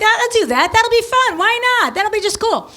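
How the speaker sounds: high-pitched, breezy excitement; eager enthusiasm. Retold or imitated. Acting out her eager decision.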